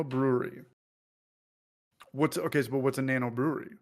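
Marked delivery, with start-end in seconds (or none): none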